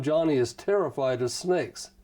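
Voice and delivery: ordinary talk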